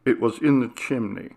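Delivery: ordinary delivery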